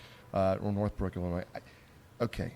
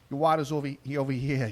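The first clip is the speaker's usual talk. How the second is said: imitating a Chicago bartender; light Chicago accent, a bit louder; 4 semitones higher, 5 dB louder than usual. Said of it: "in her Chicago accent".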